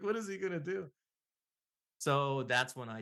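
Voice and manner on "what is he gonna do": much higher sneering mock voice; mocking coworker. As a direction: scoff at his prospects.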